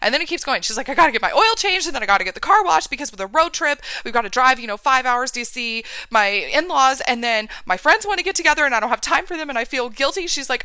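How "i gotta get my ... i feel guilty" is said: frantic overwhelmed client. Retold or imitated. Client's frantic list of chores.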